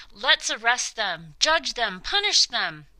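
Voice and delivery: rally the mob to justice; angry crowd. Acted. Angry crowd demands punishment.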